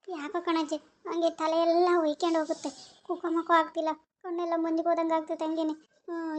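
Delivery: daughter-in-law telling grandma she feels faint, very soft; faint and dizzy. Acted. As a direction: barely get the words out, dizzy. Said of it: "ತಲೆ ಸುತ್ತೈತ್ ಕಣಜ್ಜಿ".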